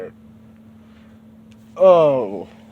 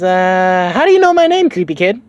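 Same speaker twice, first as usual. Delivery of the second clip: put-on much higher, louder wary girl voice; suspicious Bulma; 7 semitones higher, 7 dB louder than usual. Bulma to the strange kid, high and loud. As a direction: challenge the creepy kid.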